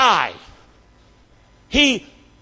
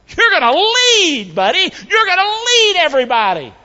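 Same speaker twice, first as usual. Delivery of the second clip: emphatic proclamation; 8 semitones higher than usual. Acted. Preacher voices Jesus appointing Peter as leader, emphatic proclamation. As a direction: stretch it out, pitched up high.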